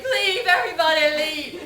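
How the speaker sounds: high, loud yell to clear out; mock panicked shouting; retold desperate order. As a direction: shout everybody away, hammed-up.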